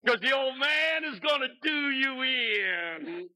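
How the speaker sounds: put-on slow, booming old preacher; old pastor's boast. Old pastor warning the young preacher, slow and booming. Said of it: "He said".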